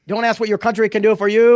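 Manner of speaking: put-on terrible Boston accent, loud and high; hammed-up Boston speechmaker's accent; Kennedy. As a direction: proclaim it in a badly faked Boston accent. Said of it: "it was a terrible Boston accent".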